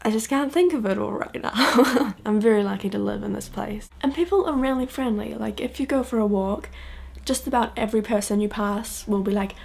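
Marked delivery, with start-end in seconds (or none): none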